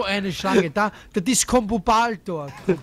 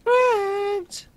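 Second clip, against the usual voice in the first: called out much higher; 12 semitones higher than usual. Real, not acted. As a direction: pitch the name way up to be heard. Calling out again, louder pitch. (hoch gerufen)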